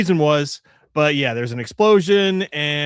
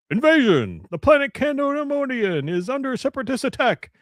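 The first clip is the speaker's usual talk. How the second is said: put-on lightly raised old-time announcer voice; 1950s narrator; 7 semitones higher than usual. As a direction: read it like a hint of a newsreel. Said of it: "That was good".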